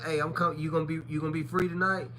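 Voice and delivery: quietly disguise your voice as a lover on the phone; a hushed, sweet-talking caller; soft disguised voice. Retold or imitated. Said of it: "I talked to him like".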